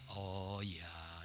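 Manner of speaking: soft, low, mild acceptance; calm low answer. Shown as a demonstration. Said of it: "the answer won't be". (jawaban pelan bernada rendah)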